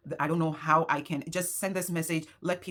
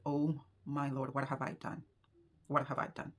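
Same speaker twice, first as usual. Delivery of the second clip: quiet despair; 3 semitones lower, 9 dB quieter than usual. Retold or imitated. Despair after being scammed.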